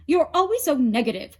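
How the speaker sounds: quick loud reproach; scolding. A narrator's reading. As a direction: scold her fast. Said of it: "said Poseidon".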